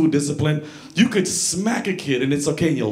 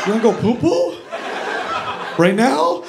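surprised question; 8 semitones higher than usual. Acted. Startled grandson questions grandpa.

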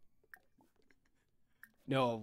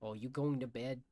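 usual speaking voice

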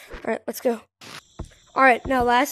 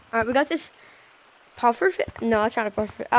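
usual speaking voice

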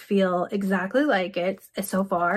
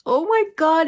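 delighted gasp; 8 semitones higher, 6 dB louder than usual. Real, not acted. Seeing the glitter on her lips.

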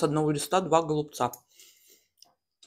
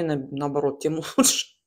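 ordinary talk

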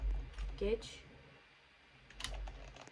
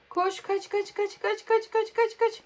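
panicked urging; 9 semitones higher, slower than usual. Real, not acted. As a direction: urge a desperate getaway. Katilden panikle kaçıyor. (panikle tiz sesle tempolu tekrar)

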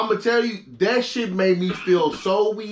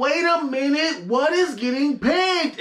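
confused surprise; 10 semitones higher, slower than usual. Retold or imitated. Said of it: "And I'm like".

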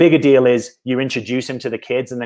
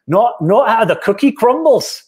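high, slow, hammed-up British accent; put-on British accent; 9 semitones higher, 4 dB louder, slower than usual. Shown as a demonstration. Coach joking with an idiom in a put-on British accent. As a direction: ham up a British accent, drawn out and loftily pitched. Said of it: "I'm putting on a British accent".

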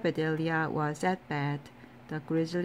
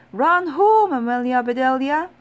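shouting; 6 semitones higher, 10 dB louder than usual. A narrator's reading. Boys urging the runner to home plate, high loud shout. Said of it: "shouted the boys".

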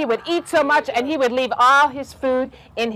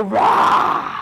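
big breathy, voiceless "rah" roar; lion roar; 4 semitones lower than usual. Shown as a demonstration. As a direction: make a long, rough lion roar. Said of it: "when I say lion, can you go".

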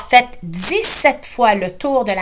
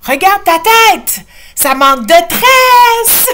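high, loud and slow teasing shout; 10 semitones higher, 9 dB louder, slower than usual. A narrator's reading. Ashley and grandma shout at mother. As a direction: call out to tease her.

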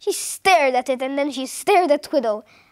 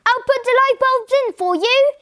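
high, raised-voice call across the house; shouting to another room; 9 semitones higher, 4 dB louder than usual. A narrator's reading. Husband shouting to his wife in the drawing room, high calling voice. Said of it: "he shouted to Missus Twiddle".

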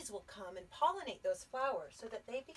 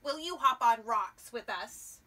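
much louder frog; loud frog inviting squirrel; 11 dB louder than usual. Acted. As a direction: call it out like a cheery frog. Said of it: "said Frog".

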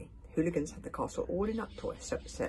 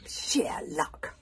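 ordinary delivery

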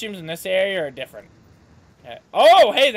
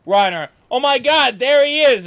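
alarmed shout; 5 semitones higher, 8 dB louder than usual. Retold or imitated. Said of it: "And be like".

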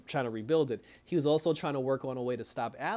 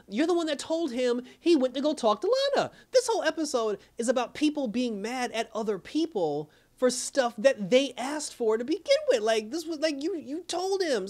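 exasperated; 9 semitones higher, 4 dB louder than usual. Real, not acted. Ranting at the character.

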